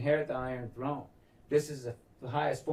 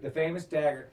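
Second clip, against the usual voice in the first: usual speaking voice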